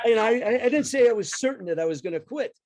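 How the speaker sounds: put-on a kid scrambling to take it back, half laughing; panicked protest. Son protesting to his dad about the dishwasher job, panicked and laughing.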